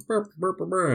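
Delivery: high mock-frantic "burp burp"; imitated babble noise. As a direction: rattle off high nonsense noises. Imitated noise capping the disaster list.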